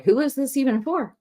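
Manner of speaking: exasperated. Real, not acted. Vlogger mocking the pointless hearing, incredulous and high. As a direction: throw up your hands asking it.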